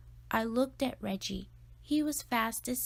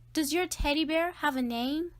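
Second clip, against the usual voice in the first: asking louder, a bit higher; 5 semitones higher, 4 dB louder than usual. Acted. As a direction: repeat it louder so he hears. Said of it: "I said louder".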